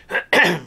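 rough throat clear. Real, not acted. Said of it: "my coughing".

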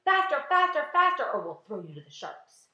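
loud, high shout falling at the threat; frantic shouting. A narrator's reading. Centipede threatening the spinners below, frantic shout. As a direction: spur them on frantically at full voice. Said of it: "exhorting them both frantically".

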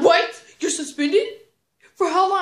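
loud, high-pitched outraged disbelief; shocked mom. Retold or imitated. Mom hearing of the suspension in the car, loudly shocked. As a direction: fire off incredulous questions as a mock-shocked mother.

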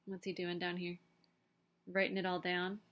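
plain reading voice

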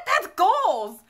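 loud, high-pitched mock admiration; retold excitement. Cousin admiring a blacked-out drunk girl, imitated loud excitement.

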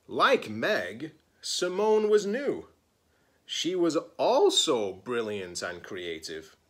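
lively picture-book read-aloud; story narration. Acted. Librarian reading a picture book to her students, expressive storytelling. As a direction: read it like story time for kids.

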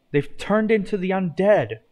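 high-pitched alarmed yell. A narrator's reading. Rage sees zombie Illagers in the forest, yelling. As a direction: raise the pitch as if yelling in a storybook. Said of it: "Rage yelled".

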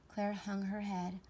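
ordinary delivery, nothing marked

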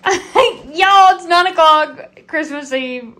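loud, high outburst easing down by the end; exclaiming at the hour. Real, not acted. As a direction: announce it in laughing disbelief. Realizing how late it is Christmas Eve.

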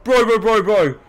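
loud, high, startled panic; panicked yelling. Real, not acted. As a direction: blurt it out in real alarm. Startled by enemy at the door.

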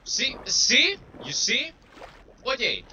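loud, high triumphant see; gloating. Real, not acted. Gamer gloating at the game, loud and high. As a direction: crow over the game like you won. Gloating after proving a jump.

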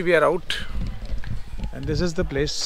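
usual speaking voice

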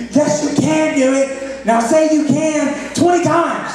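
high, loud and slow, building to a shout; dad ordering his daughter to say she can; firm parent command. Retold or imitated. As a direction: insist like a drill-sergeant dad. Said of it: "And we would say".

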